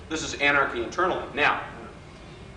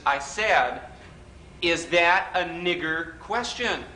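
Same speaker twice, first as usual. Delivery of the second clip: slow, deliberate repeat; 3 semitones higher, much slower than usual. Retold or imitated. Alinsky repeats it slowly.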